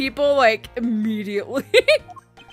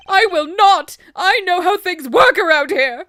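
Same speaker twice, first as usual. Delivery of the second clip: higher, louder whiny protest; whiny jock voice; 5 semitones higher, 5 dB louder than usual. Acted. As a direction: whine like a spoiled jock. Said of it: "you're getting whinier as I go".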